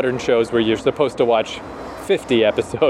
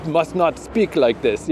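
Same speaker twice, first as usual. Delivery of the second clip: exaggerated fake Russian accent; mock Russian accent; 3 semitones higher than usual. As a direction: play a jokey Russian guy. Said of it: "what if I was, uh".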